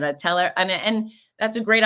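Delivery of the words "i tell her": usual speaking voice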